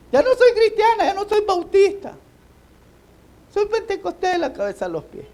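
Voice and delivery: very high, loud pleading voice; mock martyr's recantation. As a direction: squeal out the denial like a terrified victim. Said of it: "iban gritando". (imitando a un mártir asustado, voz muy aguda)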